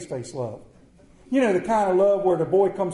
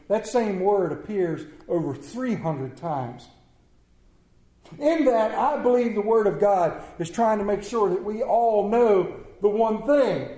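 ordinary talk, nothing marked

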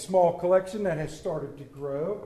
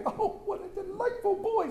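much higher, laughing through it; charmed giggle; laughing delight; 12 semitones higher, 5 dB quieter than usual. Acted. Scrooge laughing at the boy.

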